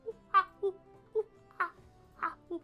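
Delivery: cute cooing. Pretend. Playful vocal noises.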